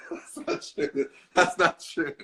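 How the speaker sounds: breathless laughing, slow protest; laughing denial. Real, not acted. Teased by co-star, protesting through laughter. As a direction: laugh the protest out, barely voiced. Denying a teasing claim, laughing.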